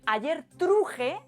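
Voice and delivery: "truje" said as true-heh with a lifted voice; teacher voicing the archaic "ayer truje". Shown as a demonstration. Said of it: "en lugar de decir".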